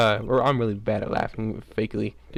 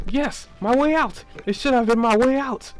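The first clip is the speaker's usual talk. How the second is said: high character voice; 10 semitones higher than usual. Acted. Character answers, high and slow.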